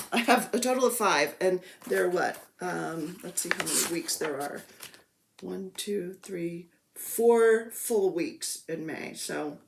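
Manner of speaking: ordinary talk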